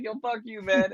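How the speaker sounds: playful high-pitched comeback; imitating cousin. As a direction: toss it back like an amused older cousin. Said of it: "She's like".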